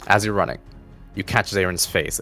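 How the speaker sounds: ordinary talk, nothing marked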